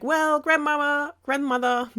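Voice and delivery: lightly voiced girl calling at the door; Red calling. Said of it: "is like".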